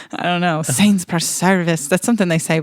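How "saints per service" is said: slow, put-on Irish accent. Shown as a demonstration. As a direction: amateurish Irish catchphrase. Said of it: "but I'm saying it wrong".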